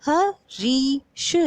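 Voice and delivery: "हरीश" very slowly in pieces, ह, री, श, with री held long; stressed long ई. Shown as a demonstration. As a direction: sound out "हरीश", stretching the middle री. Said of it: "जोर दे के बोली जा रही है". ("हरीश" को तोड़कर, री पर ज़ोर दें)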